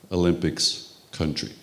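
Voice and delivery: normal talking voice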